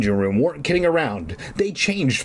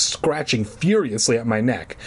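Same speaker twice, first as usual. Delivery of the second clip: ordinary talk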